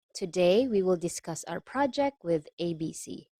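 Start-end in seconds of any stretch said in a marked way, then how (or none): none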